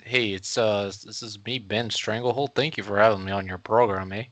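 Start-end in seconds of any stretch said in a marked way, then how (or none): 0.0-2.5 nervous, hesitant neighbor guy; awkward neighbor voice. Acted. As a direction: play an uneasy suburban neighbor. Guest character voice.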